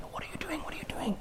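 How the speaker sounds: whispered onlookers' question; hushed alarm. Acted. Bystanders warn the man about the governor, hushed whisper. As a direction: whisper it, barely audible.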